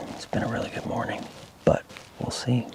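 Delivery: hushed whisper watching deer; wildlife watching whisper. Real, not acted. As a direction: breathe it out quietly on stand.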